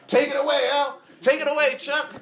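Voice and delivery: high, loud call to the guitarists; stage hype call. Retold or imitated. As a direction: hand the solo over like a frontman. Rodney throwing solos to players.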